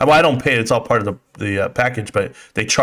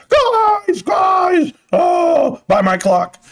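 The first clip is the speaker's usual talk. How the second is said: Uncle Bruce impression; much slower than usual. Mocking Uncle Bruce for the stream chat, high yelling impression. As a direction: squeal it out like a hyped-up uncle hawking his wares. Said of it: "Uncle Bruce".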